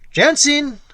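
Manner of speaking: calling out high and loud across the room; loud summoning cry. Acted. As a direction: shout the name into the tavern. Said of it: "cried in a loud tone".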